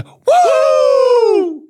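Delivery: very high, loud wrestler's whooping shout; Ric Flair woo. Retold or imitated. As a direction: let out a big wrestling-promo woo. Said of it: "maybe it's Ric Flair".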